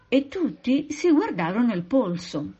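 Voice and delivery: ordinary delivery, nothing marked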